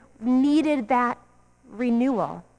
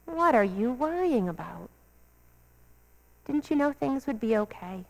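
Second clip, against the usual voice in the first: calm reassurance; 8 dB quieter than usual. Retold or imitated. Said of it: "And Jesus just says".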